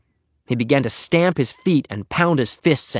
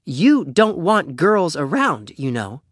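put-on slow, high girl's voice, dropping at the end; pointed girl; 5 semitones higher, slower than usual. A narrator's reading. Beezus throws his no-girls rule at Henry, pointed. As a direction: draw out the reminder pointedly.

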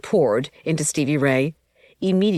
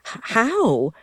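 a surprised, stammering how, pitched up; stuttered; 7 semitones higher than usual. Acted. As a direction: stumble over the start of how. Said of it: "I stuttered".

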